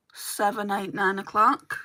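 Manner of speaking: usual speaking voice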